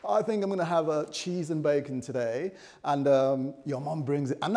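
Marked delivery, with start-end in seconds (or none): 0.1-2.5 son to his mom. Acted. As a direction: play a son waving off mom's offer, pitched up. Son turning down mom's breakfast offer.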